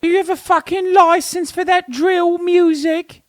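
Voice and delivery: very high, hammed-up London copper; squeaky cop demanding a license, bruv; London cop impression. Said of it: "they literally are like".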